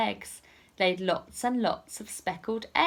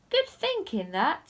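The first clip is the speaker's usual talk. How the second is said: pleased thief; 8 semitones higher than usual. Acted. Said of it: "said Lanky Len".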